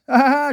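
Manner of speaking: high, loud acted laugh; high-pitched "ha ha ha" cackle; chuckle. A narrator's reading. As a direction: laugh with open delight. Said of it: "she chuckled to herself".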